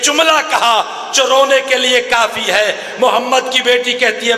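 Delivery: full-force mourning oration; impassioned majlis preaching. Acted. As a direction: build the lament to its peak for the mourners.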